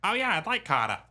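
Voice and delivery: high Boston wife voice; strong Boston accent. Retold or imitated. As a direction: imitate a Bostonian, Carter as "Cahta". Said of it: "the strongest Boston accent".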